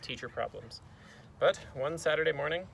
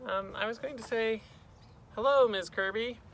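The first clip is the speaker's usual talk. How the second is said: put-on high, hesitant little-boy voice; higher-pitched, tentative kid; timid schoolboy; 8 semitones higher than usual. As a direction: greet a scary teacher as a small boy. Boy nervously greets his teacher.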